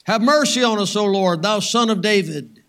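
loud plea for mercy; crying out. Acted. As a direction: call out over a crowd to the Lord. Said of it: "Some blind men crying out".

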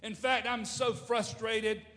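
slow, high, loud exasperation; building mock-exasperated outburst; imitated frustration. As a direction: act out a fed-up woman, stretching it out. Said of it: "this young lady was so angry".

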